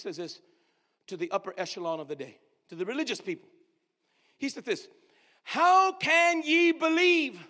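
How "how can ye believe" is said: HOW CAN YE BELIEVE drawn out slowly, falling at the end; challenge as statement. Retold or imitated. Preacher quotes Jesus to the religious people, challenge as statement. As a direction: declare "How can ye believe" slowly, each word weighted, not asking. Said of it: "he's saying it as a statement".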